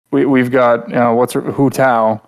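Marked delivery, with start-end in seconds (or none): none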